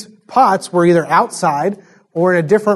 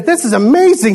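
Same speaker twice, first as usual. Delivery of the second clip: higher, thrilled exclamation; amazed master; 6 semitones higher than usual. Retold or imitated. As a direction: master of the feast praising the wine, thrilled. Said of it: "He says".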